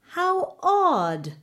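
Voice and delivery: high, startled queen's exclamation; a queen caught off guard by the news; surprised exclamation. A narrator's reading. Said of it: "the queen exclaimed".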